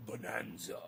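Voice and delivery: whispered aside. Real, not acted. Whispered punchline after teasing the dog. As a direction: breathe the word out unvoiced.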